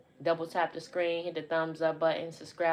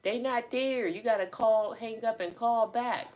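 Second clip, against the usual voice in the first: high-pitched imitation of a phone yeller; raised, insistent mock holler; mock yelling; 6 semitones higher, 4 dB louder than usual. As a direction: mimic someone hollering into a cell phone. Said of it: "She's yelling at her cell phone".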